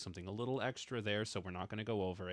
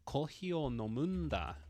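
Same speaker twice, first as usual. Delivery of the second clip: dramatic higher-pitched delivery of "Kohi o nomunda."; dramatic emphasis; 6 semitones higher than usual. Shown as a demonstration. Teacher shows learners the dramatic nda ending, higher, dramatic. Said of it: "to make it more dramatic".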